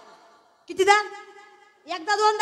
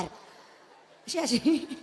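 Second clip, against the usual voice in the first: laughing; 5 semitones lower, 6 dB quieter, much slower than usual. Real, not acted. Laughs at her own mockery.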